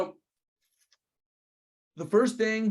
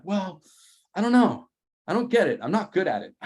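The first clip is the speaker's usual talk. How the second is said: higher, louder whiny "I don't know"; whiny helpless; 6 semitones higher, 4 dB louder than usual. Retold or imitated. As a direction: play it helpless and exasperated. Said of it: "And I'm like".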